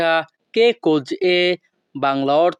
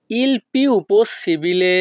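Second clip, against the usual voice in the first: "il più possibile" slowly, each word apart; slow repeat; 3 semitones higher, much slower than usual. Shown as a demonstration. Teacher repeating for students, slow and careful.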